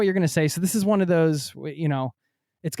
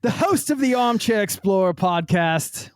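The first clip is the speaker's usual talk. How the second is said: loud, higher, slow hammed-up British announcer; British accent; 9 semitones higher, 3 dB louder than usual. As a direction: hype the guest in a mock British trailer voice. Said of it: "[name], your accent is fantastic".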